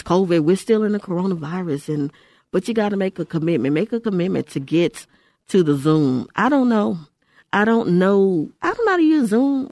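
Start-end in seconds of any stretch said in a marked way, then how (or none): 6.3-9.7 climbing, louder protest ending very high; mock helpless excuse. Host voicing listeners who balk at Zoom church, mock helplessness. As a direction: imitate a reluctant person, pitch rising to a squeak.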